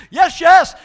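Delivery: loud high shouted "yes, yes"; dog's loud yes. Retold or imitated. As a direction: shout the "yes" like an excited dog. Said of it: "shuts her head back and says".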